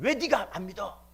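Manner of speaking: high, loud angry demand; acted thundering reproach; shouted rebuke. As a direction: burst back into a yell to scold. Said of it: "막 거기서 호통을 치니까".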